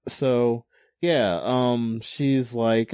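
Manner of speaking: ordinary delivery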